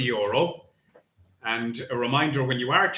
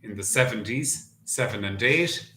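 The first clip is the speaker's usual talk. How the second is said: bingo caller. Acted. Bingo number calling.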